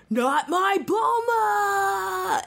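hammed-up, drawn-out outraged cry; Vegeta losing it. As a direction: wail it very high, holding the last vowel long. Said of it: "Vegeta loses his shit".

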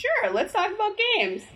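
high-pitched, put-on enthusiasm; mock eagerness. Echoes co-host's line in mock eagerness.

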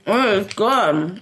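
slow, mouth full, moaning; moaning while chewing. Real, not acted. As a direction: savour it with a mouthful. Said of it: "if you're gonna moan like that".

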